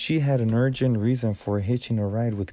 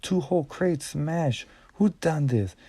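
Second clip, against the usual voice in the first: shouting; 6 semitones higher than usual. A narrator's reading. Team leader yells at the workers, outraged. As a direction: demand an answer from the crowd. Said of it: "Chugger was shouting".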